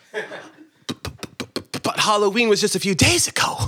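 panicked stutter on but; st-st-stammering disbelief; stammering in shock. Acted. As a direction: stutter it out, aghast. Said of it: "my voice shaking".

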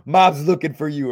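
mock call through the window; lightly voiced shout that Mom wants you. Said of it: "I said something like".

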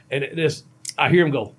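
ordinary talk

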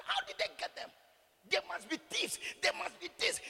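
high-pitched, scandalised onlookers; mock astonishment. Preacher voicing onlookers surprised at pastors' wealth, mock-incredulous. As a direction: imitate gossips leaping to accusations. Voices suspicious onlookers accusing.

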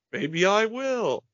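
high drawn-out sing-song; Norman Brenner. Retold or imitated. Fan quoting the nervous actor's scene, high sing-song. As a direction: quote it with a slow swooping lilt. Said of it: "this sing-song in his voice".

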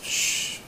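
soft, drawn hush; hushing shh. Retold or imitated. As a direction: hush the crowd quietly. Imitating the crowd falling silent.

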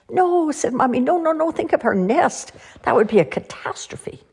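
high alarmed protest; mommy owl aghast at an elephant. A narrator's reading. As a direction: squeal in mock horror.

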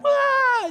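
high startled yelp as if thrown; a sudden high squeal of being pushed; playful high shriek; mock yell. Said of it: "Not literally like".

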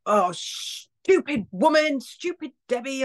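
high, slow mock scolding of herself; self-scolding. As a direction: snap at yourself for dropping something. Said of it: "if you drop something and you're like".

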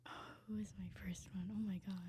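quick whisper to herself; under her breath. Real, not acted. Player trying to recall her first pick, whispering to herself. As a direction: mutter it barely audibly while thinking.

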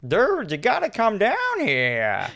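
high, slow, drawn-out mock voice; hammed-up call for help; cop on the phone. Voicing police calling the dad.

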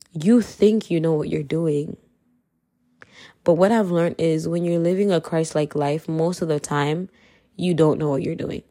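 usual speaking voice

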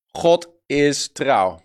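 slow, each word set apart for writing down; preacher slowly dictating to the congregation, very slow and deliberate; slow dictation. Shown as a demonstration. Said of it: "Wil je die opschrijven?".

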